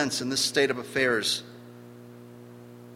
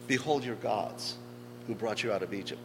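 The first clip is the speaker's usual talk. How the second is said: soft, subdued echo of a proclamation; hushed requoting; 3 semitones lower, 9 dB quieter than usual. Retold or imitated. Preacher lamenting the affront to the Lord's name, hushed requoting. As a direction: repeat it quietly, letting it sink.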